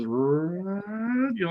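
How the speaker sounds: slow rising Victrola winding whir; wind-up noise. Shown as a demonstration. Teller demonstrates the old Victrola starting, imitated winding noise. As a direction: imitate an old gramophone cranking up, pitch climbing. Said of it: "that wind up thing, like it goes".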